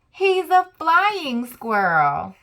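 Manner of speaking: high, loud and slow proud reveal; triumphant. Acted. Revealing to listeners he can fly, triumphant and slow. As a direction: announce it like a big surprise.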